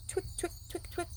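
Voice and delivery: quiet clicky toenail noises, breathy; soft twick sounds. A narrator's reading. Reading a picture book to children, quiet toenail clicks. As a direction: tap it out softly like claws on pavement. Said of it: "his toenails scratch the sidewalk".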